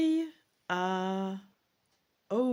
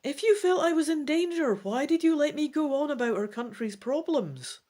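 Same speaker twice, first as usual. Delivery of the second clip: suspicious elf; 9 semitones higher, 3 dB louder than usual. Acted. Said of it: "the elf says suspiciously".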